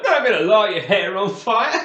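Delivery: loud, high retold boast through laughter; cocky mock announcement. Prankster taunting his long-haired friend, cocky mock announcement.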